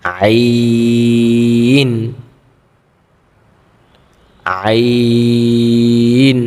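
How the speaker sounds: আইন drawn out slowly, pressed from the throat; throat-pressed ain. Shown as a demonstration. Teacher showing students how to press আইন from the throat. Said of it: "গলা একটু চাপ দিয়ে পড়তে হবে কণ্ঠতে".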